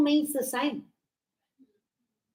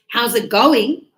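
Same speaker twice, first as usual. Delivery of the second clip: loud, clearly separated "how's it going"; emphatic repeat; 7 dB louder than usual. Retold or imitated. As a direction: imitate repeating "how's it going" for someone puzzled. Ex repeats greeting, not understood.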